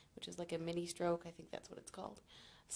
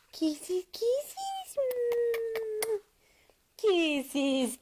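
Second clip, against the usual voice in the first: much higher, louder sing-song cooing; baby talk; 7 semitones higher, 12 dB louder, slower than usual. Acted. Mom cooing kisses at her baby, high loud sing-song baby talk. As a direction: coo at the baby in a bright lilting voice with rising kisses.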